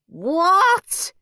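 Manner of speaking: high, loud howl of outrage; a shocked, loud howl. A narrator's reading. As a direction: howl it in disbelief. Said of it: "he howled".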